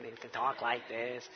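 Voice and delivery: a hint of a nasal Michigan accent; mock the nasal Michigan way of talking, understated; nasally accent. Shown as a demonstration. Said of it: "They kinda talk with a nasally accent".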